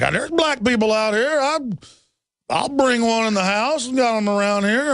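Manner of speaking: a hint of a backwoods desert rancher; hillbilly drawl. Host mimics desert hillbillies to his listeners, light hillbilly accent. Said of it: "that do talk like this".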